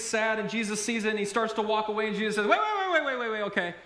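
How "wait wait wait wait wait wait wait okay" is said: high, rapid-fire panicky calling back; hammed-up scramble to stop him leaving; mock desperate plea. Imagines Jesus desperately chasing the man.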